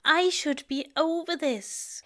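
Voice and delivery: high, soft, frustrated self-reproach. A narrator's reading. Aziraphale blaming himself to Crowley, quietly frustrated. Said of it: "frustration lacing his words".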